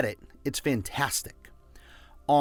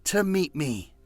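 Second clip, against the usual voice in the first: slow and heartfelt, savoring each word; felt, slow affirmation; 3 semitones higher, slower than usual. Shown as a demonstration. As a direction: say it like you feel it. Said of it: "Feel it".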